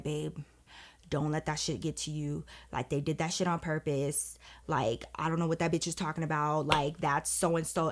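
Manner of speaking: soft, low hushed reassurance; whispering. Retold or imitated. Husband soothing her at the school event, soft hushed voice. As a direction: murmur it so no one nearby hears. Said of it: "he starts whispering to me".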